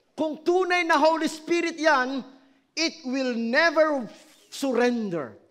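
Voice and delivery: loud, driving sermon voice; fiery preaching. Acted. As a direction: preach it hard to the congregation. Pastor preaching at full force.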